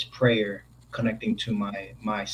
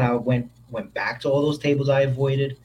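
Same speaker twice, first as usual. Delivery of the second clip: ordinary talk, nothing marked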